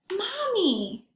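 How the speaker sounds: longing call. Acted. Rabbit longing for his mom.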